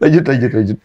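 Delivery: high, loud, breaking into laughter; laughing banter. Real, not acted. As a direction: blurt the joke, laugh, then urge them on. Melontarkan candaan berlebihan lalu tertawa. (berkelakar keras sambil tertawa)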